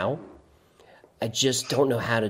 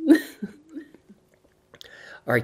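ordinary talk, nothing marked

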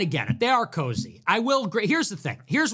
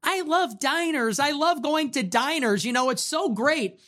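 mock diner enthusiast; 7 semitones higher than usual. Mimicking diner fans for listeners, mock gushing. Mocking people who love diners.

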